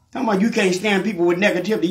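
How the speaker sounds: normal talking voice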